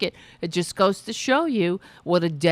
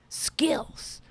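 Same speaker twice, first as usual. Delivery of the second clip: sarcastic whisper; 7 semitones higher, 6 dB quieter than usual. Real, not acted. Sarcastic thanks to Tina.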